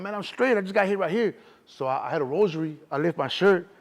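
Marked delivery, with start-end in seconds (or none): 0.0-1.4 higher and louder, keyed up; shaken reassurance. Retold or imitated. Shot in the neck, telling mom he's fine, keyed up. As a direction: insist you're fine, amped. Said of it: "I'm like".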